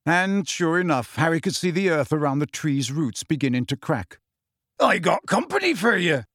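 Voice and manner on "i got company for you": high sing-out to a giant; raised, high-pitched announcement; Hagrid shouting. Acted. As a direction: call up to get his attention. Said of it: "Hagrid shouted".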